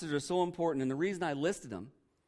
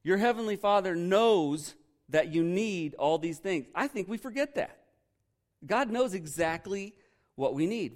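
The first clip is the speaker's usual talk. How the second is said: ordinary talk, nothing marked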